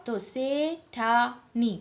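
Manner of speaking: શેઠાની drawn out, stressing આની; slow teaching demo. Shown as a demonstration. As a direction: sound out શેઠાની slowly. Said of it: "આનો જ્યારે ઉચ્ચાર થાય છે".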